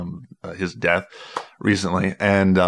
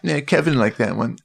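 Leno voice; 4 semitones higher than usual. Retold or imitated. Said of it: "always referring to Kev".

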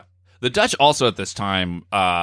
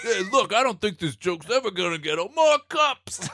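high put-on voice rising to a peak; comic mover; 8 semitones higher than usual. Performer milks the mover bit.

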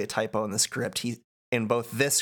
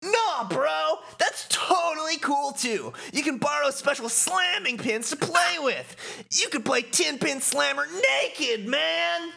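very high, chummy bro voice; high cartoon enthusiast; excitable game fanatic. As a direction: gush it up high, totally stoked. Voices Shooter.

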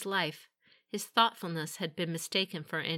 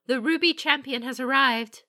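high, loud servant's announcement; servant announcing; 7 semitones higher, 10 dB louder than usual. A narrator's reading. Servant announces a visitor to the master, calling out loudly. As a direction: call it out like a servant. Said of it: "came the servant's voice".

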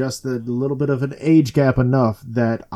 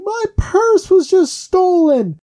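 very high, loud, drawn-out wail; mock screaming victim; 5 dB louder, slower than usual. Robbed woman to her rescuers, hammed-up scream. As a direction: imitate a woman screaming bloody murder. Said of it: "this woman just bloody murder screaming".

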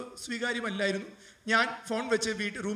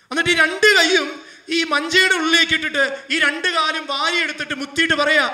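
impassioned preaching; 7 semitones higher, 10 dB louder than usual. Acted. Preaching the father's remorse.

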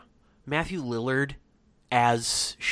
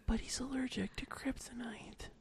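sultry Casey Kasem; 8 semitones higher, 10 dB quieter than usual. Acted. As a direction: whisper it like a smooth DJ. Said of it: "like a sultry Casey Kasem".

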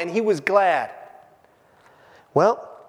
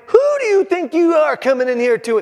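loud, high angry parent; scolding parent; 9 semitones higher, 6 dB louder than usual. Retold or imitated. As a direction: scold a kid sneaking home late. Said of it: "like when a kid comes in at two A M".

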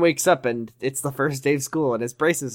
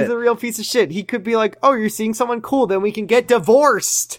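mock voice; 8 semitones higher, 4 dB louder than usual. Mocking Ben, high voice.

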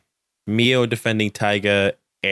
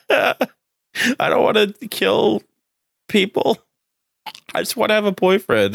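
high-pitched, hammed-up tearful whine; mock crying; 12 semitones higher than usual. Imitating Mio not wanting to kill people, mock crying. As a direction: imitate a weepy girl, much higher, laughing through it. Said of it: "cries. She's like".

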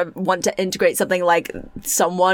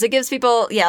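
normal talking voice

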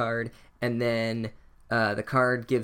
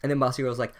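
ordinary talk, nothing marked

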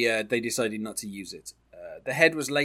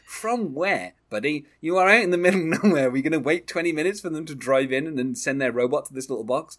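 louder incredulous mock-quote, quick at first; incredulous retort; 3 semitones higher, 4 dB louder than usual. Podcast host quoting a scoffing cop, incredulous. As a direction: scoff at the idea like a fed-up cop.

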